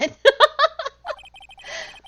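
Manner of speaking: high, loud cackle; loud burst of laughter. Real, not acted. Player cracking up at the judge's answer. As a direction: let out a big laugh.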